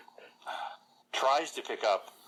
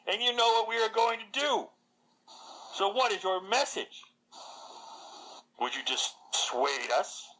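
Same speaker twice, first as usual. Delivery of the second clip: defiant warrior Agatha; 7 semitones higher than usual. Acted. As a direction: hurl it back at the envoy, much higher. Agatha defies the necromancer's envoy.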